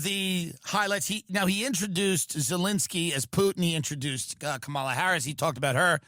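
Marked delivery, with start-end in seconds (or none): none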